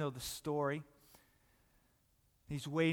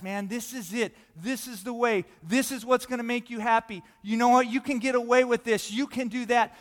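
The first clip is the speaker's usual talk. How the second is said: raised, insistent hawker's pitch; clamor for their attention, growing louder; pushy, higher voice building up; mock screaming; 4 semitones higher, 7 dB louder than usual. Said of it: "so many things are screaming at you guys".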